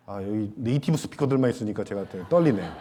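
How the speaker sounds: ordinary delivery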